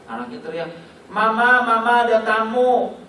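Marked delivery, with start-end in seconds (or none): 1.1-3.0 put-on high, loud child's call. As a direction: shout it like a small kid at the door. Child shouting at door. (anak kecil berteriak memanggil mama)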